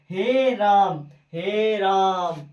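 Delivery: हे राम with both vowels stretched long; prolonged vowels. Shown as a demonstration. Teacher giving a triple-length vowel example, हे राम drawn out. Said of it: "बोलते रहते हैं".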